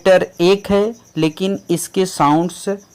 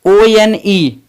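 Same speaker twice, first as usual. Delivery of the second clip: normal talking voice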